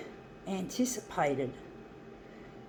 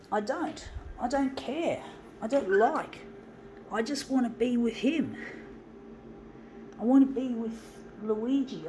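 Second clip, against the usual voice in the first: raised, distressed pleading voice; upset; 5 semitones higher than usual. Real, not acted. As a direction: near tears, longing for him. Lonely, missing Luigi at Christmas.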